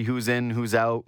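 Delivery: ordinary talk, nothing marked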